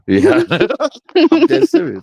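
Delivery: high, loud laughing yeah. Real, not acted. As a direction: laugh it out while insisting. Laughing through his reply.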